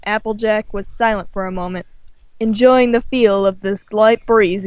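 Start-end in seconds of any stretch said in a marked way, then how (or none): none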